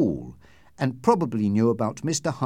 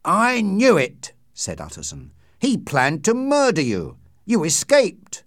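realising with alarm; 8 semitones higher, 7 dB louder than usual. A narrator's reading. Lawyer Utterson realising Hyde planned murder, alarmed outburst. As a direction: burst out as the truth hits.

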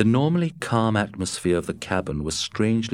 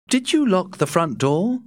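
higher, puzzled Matt voice; 12 semitones higher, 3 dB louder than usual. A narrator's reading. Matt puzzling over the wolf to Scott, higher and puzzled. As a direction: pitch Matt up, wondering aloud. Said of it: "Matt asked".

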